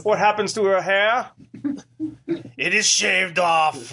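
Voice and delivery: put-on lightly done German accent, slow. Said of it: "first ever North Korean German accent".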